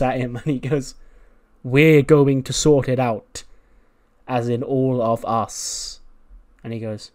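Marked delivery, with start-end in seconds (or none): 1.6-6.0 slow pointed warning. Retold or imitated. Asa warns Andrews.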